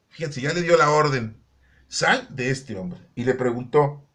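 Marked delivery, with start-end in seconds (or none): 1.9-3.0 slow, weighty order; measured, deliberate quoted command; retold command. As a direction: space the command out firmly. Same command repeated.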